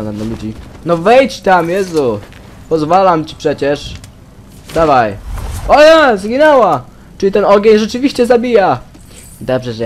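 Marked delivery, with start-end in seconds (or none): 0.8-5.2 raised, impatient commands; exasperated urging. Real, not acted. Player urging a game character to go in, loud exasperation. 5.7-8.8 high, loud amazement; shocked exclamation. Real, not acted. Player seeing that the fire really kills, high shocked shout. Game character dies in fire.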